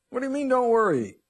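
high, loud incredulous outburst; exasperated listener. Acted. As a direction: cry it out like an exasperated churchgoer. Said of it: "you may be sitting there going".

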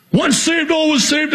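high-pitched mocking quote of an opponent; mock objector. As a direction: quote the other side in a high, strained pitch. Mimicking opponents of his doctrine.

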